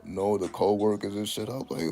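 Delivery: usual speaking voice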